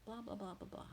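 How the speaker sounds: mock swearing. Peter cursing at his accusers, mock swearing. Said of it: "And then he swears".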